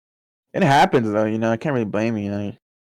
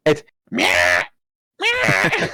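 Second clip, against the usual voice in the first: nagging cat meow; 3 semitones higher, much slower than usual. Retold or imitated. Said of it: "it's like".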